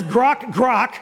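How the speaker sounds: lightly grunted grok, grok; a hint of a pig's snort. Shown as a demonstration. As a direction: give a mild piggy grunt. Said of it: "a pig says".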